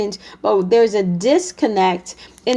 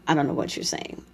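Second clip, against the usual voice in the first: much softer, lower whispered comeback; whispered aside; 8 semitones lower, 10 dB quieter, faster than usual. Acted. Partner muttering back after being yelled at, whispered. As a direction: breathe it under your breath as a dry aside.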